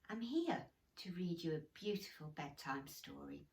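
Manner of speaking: ordinary delivery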